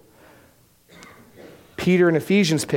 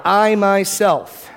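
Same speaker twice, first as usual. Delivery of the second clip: I myself, slow and louder, each word weighted; emphatic I myself; 5 semitones higher, 4 dB louder, much slower than usual. Shown as a demonstration. Preacher stressing God's own hand: emphatic I myself. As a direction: lean hard on I myself. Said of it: "notice that's emphatic".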